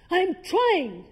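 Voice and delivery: high, loud exasperated yell; yelled back. Retold or imitated. Student snapping at the coach mid-drill, exasperated yell. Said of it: "And I yelled back".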